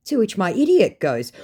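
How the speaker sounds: ordinary delivery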